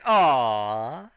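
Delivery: long, sagging crowd groan; drawn-out mock disappointed aw; crowd's disappointed aw. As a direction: let the groan dip and swell. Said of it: "It's like".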